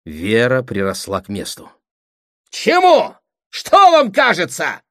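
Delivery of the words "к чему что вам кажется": high, loud, furious demand; thunderous shout. A narrator's reading. Brother pressing his sister, thunderous shout. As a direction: press the question at full voice. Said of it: "продолжал Сергей Андреевич".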